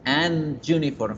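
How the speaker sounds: "an uniform" con la ene chocando contra la Y; fluidez cortada. Shown as a demonstration. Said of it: "Fíjense que se corta la fluidez". (entrecortado, forma incorrecta)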